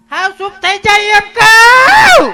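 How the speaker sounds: high, very loud drawn-out yell; scolding shout. Acted. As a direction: bellow the order, stretching it out. Orders the crying women to hush. (ગુસ્સામાં જોરથી બરાડો)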